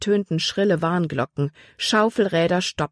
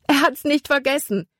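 much higher, joyful call; relieved cry; 9 semitones higher than usual. A narrator's reading. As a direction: shout out with relief. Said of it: "rief sie". (erleichterter Ruf, viel höher)

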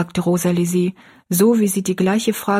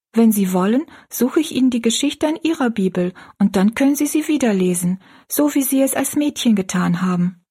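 put-on higher, gently eager girl's voice; earnest young girl; 5 semitones higher than usual. Girl offering to find a Bible story for a woman, earnest.

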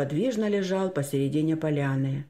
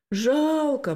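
put-on high, stretched-out girlish pity; drawn-out regret; 10 semitones higher than usual. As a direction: draw the word out sadly in a raised voice. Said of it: "протянула Анюта и погрустнела". (протяжно, с грустью)

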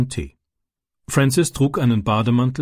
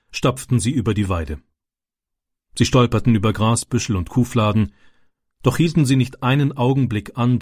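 plain reading voice

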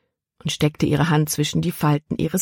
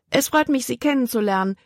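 quickly; 8 semitones higher than usual. A narrator's reading. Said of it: "sagte sie schnell".

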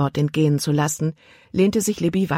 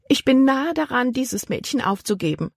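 higher, fed-up aunt voice; exasperated aunt; 9 semitones higher than usual. A narrator's reading. Aunt nearly giving up on Libby, higher exasperated voice. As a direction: pitch up, ready to give up on the girl. Aunt's voice held.

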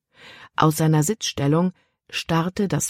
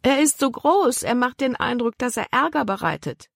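put-on higher girl's voice, worried; Libby protests to her father, higher and worried; young girl Libby; 8 semitones higher than usual. A narrator's reading. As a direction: voice the girl, anxious about the dog. Libby fearfully objects to big dog.

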